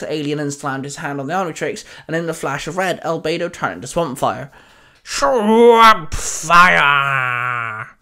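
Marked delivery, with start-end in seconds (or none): 5.0-8.0 long, loud drawn-out battle cry; called out. A narrator's reading. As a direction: shout SWAMP FIRE, stretching both words. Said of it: "Swamp Fire called out".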